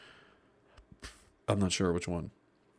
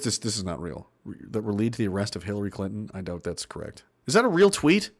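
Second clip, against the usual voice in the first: ordinary talk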